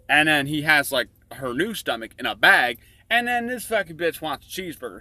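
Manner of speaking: ordinary talk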